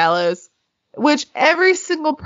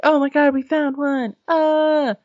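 higher-pitched mock excitement; mock freak-out; 6 semitones higher than usual. Ghost hunters finding symbol cards, mock excited freak-out. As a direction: imitate someone losing it over a find. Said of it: "lose their fucking minds".

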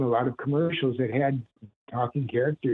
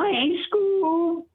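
high, rising Shaggy impression, climbing higher word by word. Shown as a demonstration. Voice actor showing off his Shaggy for the host, high and goofy. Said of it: "if I wanna do Shaggy".

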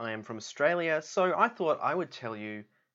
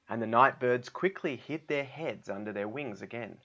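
ordinary delivery, nothing marked

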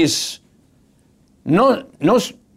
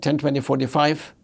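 soft personal teller; 4 semitones lower, 6 dB quieter than usual. Retold or imitated. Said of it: "she would have said".

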